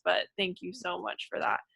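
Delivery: ordinary talk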